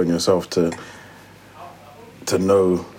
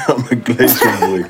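laughing; 4 semitones higher, 4 dB louder than usual. Retold or imitated. Mocking a friend's line.

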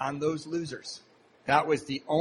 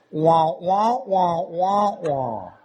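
loud, rising "wah wah" like a Peanuts adult; cartoon teacher wah-wah; muffled trombone wah; 5 dB louder, much slower than usual. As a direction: mimic unintelligible adult talk as a trombone. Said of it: "what that guy said".

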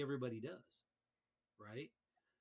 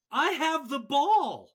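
much higher, louder, slow whiny protest; mock the player's outraged excuse; indignant player; 11 semitones higher, 13 dB louder, slower than usual. Player called for a foul whines, higher, louder and slow. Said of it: "and he goes".

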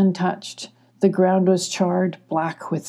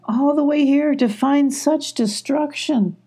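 higher-pitched storybook lament; dismayed cry; 8 semitones higher than usual. A narrator's reading. Emmy among the charred trees, a high dismayed cry. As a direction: lament it in a raised voice. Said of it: "cried Emmy".

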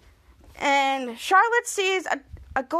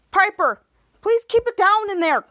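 raised, higher acted complaint; calling out; 5 semitones higher, 5 dB louder than usual. Henry calls to kitchen over racket.

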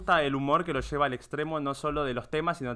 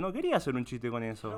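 normal talking voice

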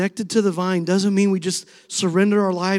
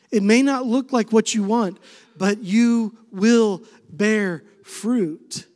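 louder, very slow promise; preaching; 4 semitones higher, 6 dB louder, slower than usual. Acted. Pastor voices Jesus' promise to the congregation, slow preaching. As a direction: land "you will bear fruit" slowly and firmly.

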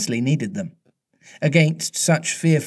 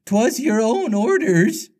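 high, slow boatswain voice; hesitant pirate underling, pitched up; faltering; 9 semitones higher, slower than usual. A narrator's reading. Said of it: "the boatswain faltered".

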